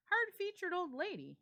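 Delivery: high, loud amused remark; laughing host. A narrator's reading. John laughing off the portrait, high and loud. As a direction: toss it off with a laugh. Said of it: "he said".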